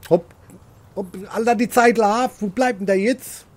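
rising impatience, high and loud; impatient urging. Real, not acted. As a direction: hurry the slowpoke along, fired up. Urging hunting partner on.

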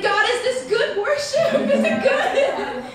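high, slow, laughing "is it good?"; mock anxious worshipper. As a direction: fret like a worried student. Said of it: "Be like".

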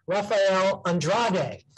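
slow announcement, "Andrade" as ahn-DRAH-day; careful correct pronunciation. Real, not acted. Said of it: "I'm gonna say it correctly now".

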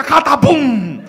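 loud, drawn-out "catapum"; vocal gun blast; imitated shotgun blast. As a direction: boom out the gunshot, stretching the last syllable.

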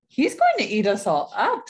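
loud, rising warning with swoops; alarmed warning. A narrator's reading. Dot warns Mama and Papa about the wolf, alarmed. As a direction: warn them with punchy alarm.

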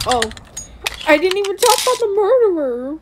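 loud exasperated yell at the game; frustrated yelling. Real, not acted. Frustrated gaming outburst.

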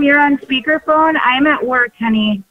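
ordinary talk

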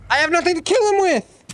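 high, loud panicked cry; panicked shout. Real, not acted. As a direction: yell in real panic. Panicking with no weapon.

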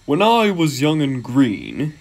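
slow, stretched boastful engine voice; engine reminiscing. Acted. Gordon talking to a visiting engine, reminiscing. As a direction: draw it out like an old engine recalling youth.

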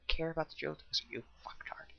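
hushed insult under the breath; whispering. Real, not acted. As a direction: drop to a whisper for the jab. Whispered insult at game character.

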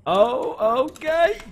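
high loud startled oh okay. Real, not acted. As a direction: jump at it, pitch way up. Sudden startled exclamation.

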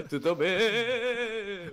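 very slow, drawn-out teasing question; mock sweet greeting. Player taunts a creeper before killing it, drawn-out and teasing. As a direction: stretch it out to taunt.

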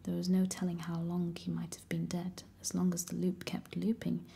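plain reading voice

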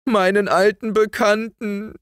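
put-on very high, anguished child's wail. A narrator's reading. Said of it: "jammerte sie".

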